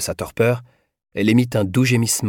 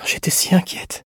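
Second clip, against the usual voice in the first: high, whispery relief; frail, pitched-up, relieved voice; relieved sigh; 10 semitones higher than usual. A narrator's reading. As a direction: let go of the worry on a thin breath. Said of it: "soupira-t-elle, soulagée".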